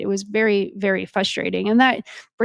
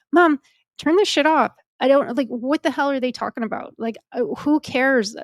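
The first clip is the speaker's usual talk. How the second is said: exasperated outburst; 4 semitones higher than usual. Retold or imitated. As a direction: burst out at mom, half yelling. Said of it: "I was like".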